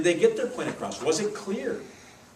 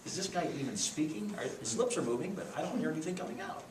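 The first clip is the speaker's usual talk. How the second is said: puzzled listener; 10 dB quieter than usual. Retold or imitated. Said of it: "It's like".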